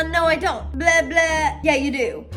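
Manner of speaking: ordinary delivery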